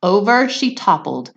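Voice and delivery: plain reading voice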